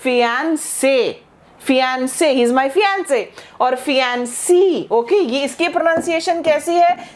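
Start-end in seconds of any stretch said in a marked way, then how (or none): 0.0-2.3 फियांसे said slowly, ending on a clear 'se'; slow teacher's model of फियांसे; pronunciation model. Shown as a demonstration. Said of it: "हम इसको ऐसे ही pronounce कर रहे हैं".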